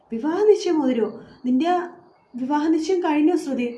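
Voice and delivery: disbelieving, pitch jumping up; shocked. Acted. Anjali shocked at engagement news. (വിവാഹ നിശ്ചയം കേട്ട് ഞെട്ടിയ അഞ്ജലി, ഉയർന്ന ശബ്ദത്തിൽ)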